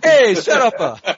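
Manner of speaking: put-on loud, high-pitched Italian-accented yell; terrible Italian accent. Player showing off a terrible Italian accent on request, hammed-up Italian yell. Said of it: "Where's your terrible Italian accent?".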